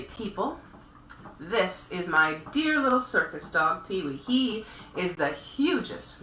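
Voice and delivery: lively, expressive storybook reading; storyteller voice reading to children; children's story narration. A narrator's reading. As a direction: read it aloud to little ones at bedtime. Reads a picture book aloud.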